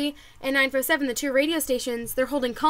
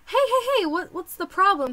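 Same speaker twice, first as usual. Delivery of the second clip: high, quick-fire hailing voice; acted calling out; 6 semitones higher than usual. Radio host stopping upset fans, high acted call. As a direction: hail them high, then ease down.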